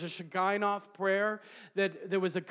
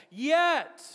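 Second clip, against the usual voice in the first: loud, high call to the congregation; preacher calling out to the crowd; ringing call; 7 semitones higher, 5 dB louder than usual. Shown as a demonstration. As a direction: lift the word yet like a summons. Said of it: "everybody say".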